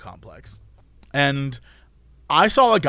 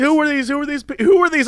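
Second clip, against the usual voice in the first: mock indignant question; 8 semitones higher than usual. Teasing mock demand.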